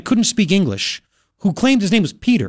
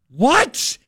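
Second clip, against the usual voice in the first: high, rising incredulous question; retold startled reaction, pitched up; baffled disbelief; 7 semitones higher than usual. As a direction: blurt it upward in mock disbelief. Retells his baffled classroom reaction.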